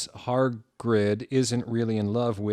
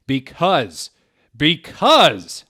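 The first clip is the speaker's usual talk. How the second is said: building louder and higher; dramatic pause; 5 semitones higher, 11 dB louder, much slower than usual. Acted. Hagrid stalling dramatically before the answer. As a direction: drag out the suspense. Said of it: "paused dramatically".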